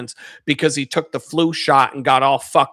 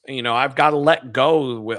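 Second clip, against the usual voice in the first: normal talking voice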